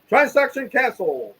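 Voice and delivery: high, slow, drawn-out proclamation; mock announcement. Bank cancelling a false charge, mock announcement. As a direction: draw it out high like an official verdict.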